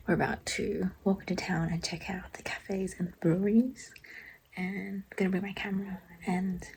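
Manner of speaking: soft whispered vlog voice; whispering. Real, not acted. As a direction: whisper it to the camera.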